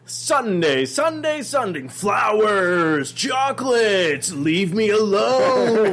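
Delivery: high, slow, loud mock tantrum; mock demanding shout. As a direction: demand your day, hammed up. Mother's day demand, shouting.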